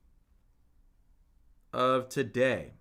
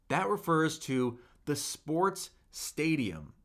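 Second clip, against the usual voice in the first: ordinary delivery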